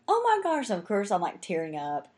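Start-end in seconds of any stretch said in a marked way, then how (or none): 0.1-0.7 high, loud gasp of gratitude; overwhelmed by the gift; touched delight. Retold or imitated. Thanking the waitress for the wedding gift, high and touched.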